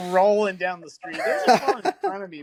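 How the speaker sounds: barely holding back laughter; excited laughing telling. Real, not acted. Laughing while telling crash.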